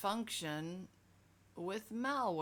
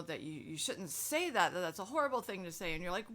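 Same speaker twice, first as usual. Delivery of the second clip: usual speaking voice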